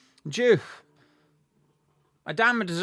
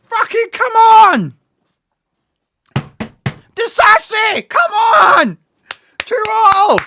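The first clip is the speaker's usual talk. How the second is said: very high, loud roars of come on; ecstatic shouting; 12 semitones higher, 9 dB louder, slower than usual. Real, not acted. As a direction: scream the team on after the goal. Celebrating equaliser, urging team on.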